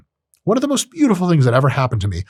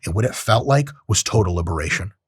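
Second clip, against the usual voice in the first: ordinary talk, nothing marked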